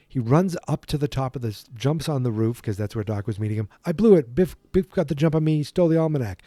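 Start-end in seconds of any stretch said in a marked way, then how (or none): 3.8-6.4 louder, higher mock yell; a hammed-up frantic report; upset shout. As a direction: call it out like bad news on the run. Marty reports to Doc, upset.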